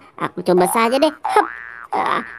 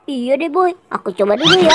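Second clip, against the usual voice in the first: usual speaking voice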